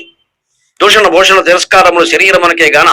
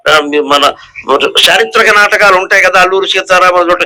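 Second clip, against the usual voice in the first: normal talking voice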